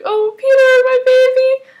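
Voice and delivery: very high, loud mock weepy cry; hammed-up, squeaky emotional outpouring; tearful joy, imitated. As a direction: imitate an overjoyed mother wailing over her child. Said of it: "crying tears of joy".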